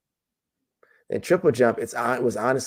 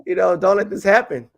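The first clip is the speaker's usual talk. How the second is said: high, louder plea at the TV; retold yelling; 9 semitones higher, 4 dB louder than usual. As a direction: imitate yourself yelling at an athlete on screen. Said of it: "I was yelling at the T V".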